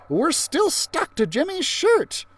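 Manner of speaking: put-on high, slow, anxious kid; worried. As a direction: whine about being stuck. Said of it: "I wondered".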